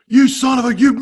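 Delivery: retelling the manager blowing up at the ump; imitating furious manager. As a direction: rail at the umpire in mock rage. Manager storming at umpire, retold.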